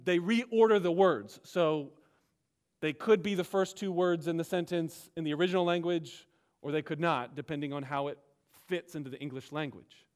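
normal talking voice

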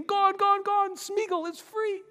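high giddy freedom cry; joyful Smeagol. Acted. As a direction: squeal it with dancing glee. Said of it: "starts dancing and jumping".

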